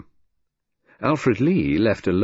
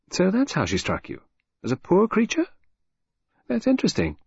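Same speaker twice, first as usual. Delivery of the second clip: intrigued echo; 11 semitones higher than usual. A narrator's reading. Lydia echoes, intrigued.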